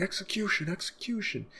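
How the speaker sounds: high chanted execution call; mock yell. Guards chanting for Luffy's execution, high mock chant. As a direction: mimic a crowd of guards. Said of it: "All the guards are yelling".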